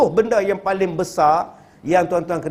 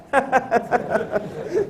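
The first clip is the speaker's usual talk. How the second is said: quiet laugh; 6 semitones higher, 5 dB quieter than usual. Real, not acted. Laughing at widow joke.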